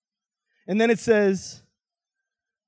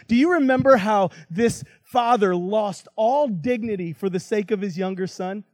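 ordinary talk, nothing marked

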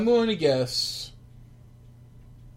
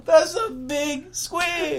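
stunned; 8 semitones higher, much slower than usual. Real, not acted. Stunned by squid size.

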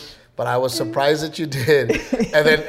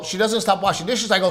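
ordinary delivery

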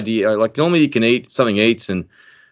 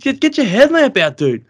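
high-pitched urgent order, re-enacted; mad panic. Angler to his mate in the dark, mad panic. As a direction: act out a frantic demand, voice way up. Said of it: "It's just a mad panic".

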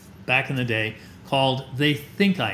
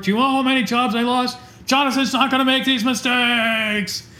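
higher, louder, fast booming big-man voice; hearty mock shout; 8 semitones higher, 4 dB louder than usual. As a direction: play the burly lumberjack dad at full volume. Big ex-student lecturing his teacher.

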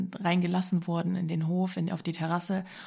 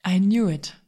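much louder, punched out; triumphant shout; 9 dB louder, slower than usual. Real, not acted. Telling the viewers she got the house, a loud triumphant shout.

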